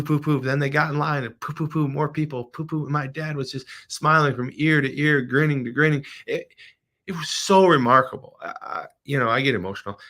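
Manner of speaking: ordinary talk, nothing marked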